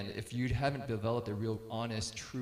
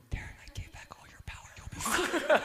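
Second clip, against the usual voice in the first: quick whisper breaking into a laugh; reassuring whisper. Retold or imitated. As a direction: whisper the comfort into his ear, holding back a giggle. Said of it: "I lean over to him".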